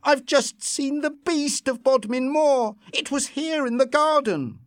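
high, frightened rush of words; Lucy bursting in, scared out of her wits; panicked. A narrator's reading. Lucy waking her husband, panicked and high. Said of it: "heard the panic in her voice".

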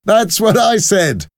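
high, loud, gleeful soldier; amused soldier. A narrator's reading. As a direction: crow it with a laugh in mind. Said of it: "the soldier laughed".

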